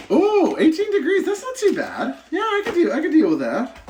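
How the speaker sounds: delighted by the number, half laughing; pleased surprise. Real, not acted. Reacting to a reading, laughing.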